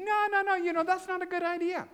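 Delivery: high, dismissive brushing-off voice; mock negotiating. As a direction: wave the request away, way up high. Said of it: "I say".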